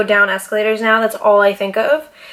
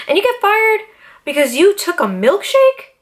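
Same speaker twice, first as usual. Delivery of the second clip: outraged disbelief; 9 semitones higher than usual. Real, not acted. Venting to viewers about her firing, high outraged disbelief.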